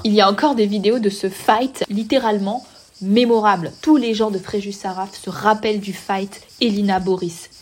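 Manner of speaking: usual speaking voice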